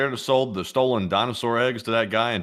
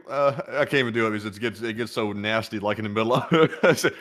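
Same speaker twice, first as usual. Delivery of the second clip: usual speaking voice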